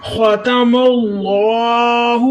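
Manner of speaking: ختم اللہ recited very slowly with a heavy, throaty خ; ختم اللہ in slow recitation, long held vowel in اللہ; tajweed demonstration. Shown as a demonstration. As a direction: draw out ختم اللہ, full heavy kha and thick lam in اللہ. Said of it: "خا کو آپ نے موٹا کرنا ہے".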